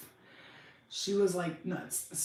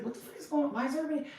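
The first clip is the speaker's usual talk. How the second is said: confused; 7 semitones higher, much faster than usual. Retold or imitated. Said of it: "I was like".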